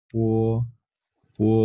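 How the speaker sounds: পো said as a plain unaspirated p with a long o; Chinese bo demo. Shown as a demonstration. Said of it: "পয়কার পো এর মতো".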